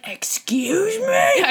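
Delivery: mom voice. Acted. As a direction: catch the kids out like a shocked mother. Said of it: "my total mom voice".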